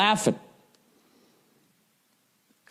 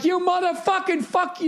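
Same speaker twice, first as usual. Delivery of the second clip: cursing, pitched way up; mock road rage yell; 13 semitones higher than usual. Crash driver yelling at bystanders, mock furious.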